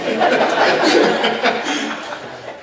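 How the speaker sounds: short amused laugh; real laugh. Real, not acted. Confessing his speed to the audience, laughing. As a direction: laugh at your own admission.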